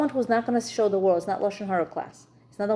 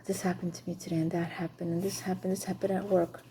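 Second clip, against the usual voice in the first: softer voice, running through the day's events one after another; quietly listing her day; 8 dB quieter than usual. Retold or imitated. Wife recounting her day to husband.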